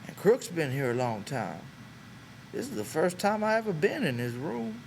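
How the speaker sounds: put-on a shy old ranch hand easing into small talk; Candy's old man voice. A narrator's reading.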